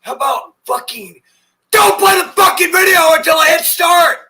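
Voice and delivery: screaming in rage at the screen; furious shout. Real, not acted. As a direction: explode with fury, yelling. Raging at video autoplay.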